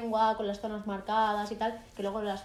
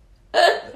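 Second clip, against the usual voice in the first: loud laugh; 7 semitones higher, 13 dB louder than usual. Real, not acted. Laughing at flubbed intro.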